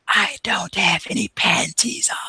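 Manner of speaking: breathy unvoiced whisper in his ear. [name] whispering to [name], sly whisper. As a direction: lean in and whisper it secretly. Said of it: "she leans in and goes".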